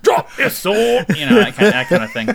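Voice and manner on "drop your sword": high, loud, slow Cockney command; Cockney guard order. Pretend. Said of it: "thick Cockney accents".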